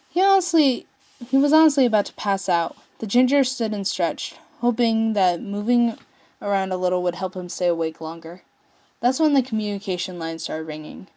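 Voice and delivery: plain reading voice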